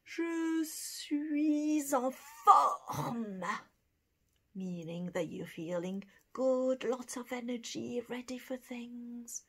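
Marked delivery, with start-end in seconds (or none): 0.0-3.4 je suis en forme stretched very slowly; slow model. Shown as a demonstration. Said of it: "We've said".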